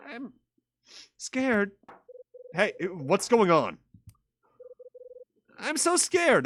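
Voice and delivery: put-on high, small frightened child voice; scared Melissa. Little girl scared behind a locked door, high frightened voice. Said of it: "That's Melissa's voice".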